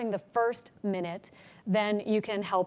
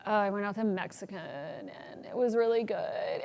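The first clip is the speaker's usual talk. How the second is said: vocal fry demo; 2 semitones lower, 7 dB quieter than usual. Shown as a demonstration. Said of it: "you're speaking way too low for your register".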